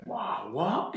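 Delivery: high-pitched awe of an onlooker; mock amazement. People react to Einstein's equation, mock amazed. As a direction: act amazed, voice shooting up. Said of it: "People are going".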